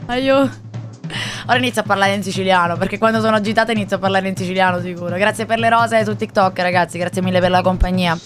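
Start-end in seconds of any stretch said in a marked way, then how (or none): none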